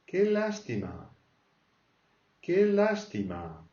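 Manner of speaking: slow, high what-a-shame; regretful pity. Shown as a demonstration. Teacher showing how to lament, slow and high. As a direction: draw out the pity. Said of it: "Quel dommage".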